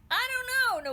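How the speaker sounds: high loud shout over the shoulder. Acted. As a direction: call back while running. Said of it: "Nawadi shouted back over her shoulder".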